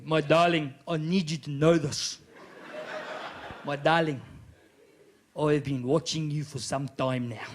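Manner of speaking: low-pitched South African suitor; creepy South African lover; South African accent. Acted. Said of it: "give him that accent".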